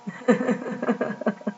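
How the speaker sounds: quick run of soft chuckles; laugh. Real, not acted. Preacher after her own firing story, soft chuckle.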